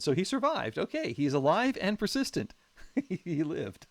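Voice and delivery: excited relief. Real, not acted. Streamer cheering his surviving unit, excited and loud.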